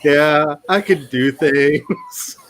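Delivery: slow, drawn-out mock enthusiasm; laugh, then drag out a feeble yes; unconvinced, slow pep talk to oneself; half-hearted readiness. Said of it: "speaking for the voice of my heart".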